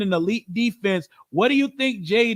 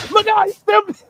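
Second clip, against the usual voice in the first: much higher, louder, cracking with delight; hyped repeat; 13 semitones higher, 7 dB louder than usual. Real, not acted. Streamer yelling at a football clip, delighted. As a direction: shout it again even higher, thrilled.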